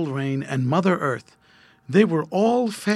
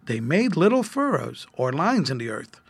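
usual speaking voice

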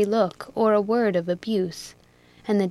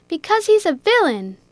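higher, louder, bitter; through gritted teeth; 8 semitones higher, 7 dB louder than usual. A narrator's reading. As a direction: spit out the word villain. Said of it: "between his grated teeth".

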